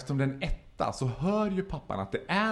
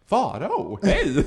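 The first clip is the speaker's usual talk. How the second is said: calling out; 6 semitones higher, much slower than usual. Retold or imitated. Acting someone hailing him on subway.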